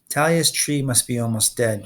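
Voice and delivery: plain reading voice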